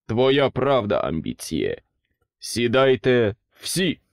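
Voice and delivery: loud, slow royal command; king roars. A narrator's reading. King orders everyone to sit, loud and slow. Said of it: "ревнув король".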